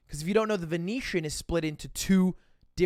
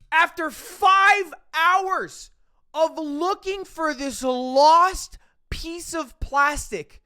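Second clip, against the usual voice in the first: much higher, louder and slow, stretched out; ecstatic disbelief; 9 semitones higher, 7 dB louder, much slower than usual. Real, not acted. Teller reaches the climax of finding his ID, slow loud elated shout. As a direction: shout it like a miracle just happened.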